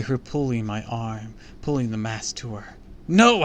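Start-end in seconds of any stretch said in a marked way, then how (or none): 3.1-3.4 high, loud cry of refusal; choked-out cry. A narrator's reading. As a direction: cry it out in desperate horror. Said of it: "I choked out".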